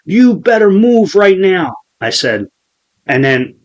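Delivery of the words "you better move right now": high, loud, slow demand; imitated threat. Retelling the kid getting closer and staring, high demanding voice. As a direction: order him off the spot, voice pitched up. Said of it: "he's fucking staring at me".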